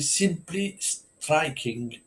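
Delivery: slow, put-on English accent on the name; self-mocking English accent on a French race tip. Shown as a demonstration. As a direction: say the horse's name like an Englishman, slowly. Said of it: "Remarquez mon accent anglais, hein, terrible".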